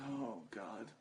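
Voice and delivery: soft, breathy "oh God" of relief; relieved sigh. Retold or imitated. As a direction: sink into the bed with a quiet groan of relief. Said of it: "this is the noise I made".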